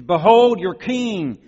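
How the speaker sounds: slow, high, ringing announcement; drawn-out shouted presentation; loud proclamation. Acted. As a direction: present him to the crowd at full voice. Pilate presenting the king to crowd.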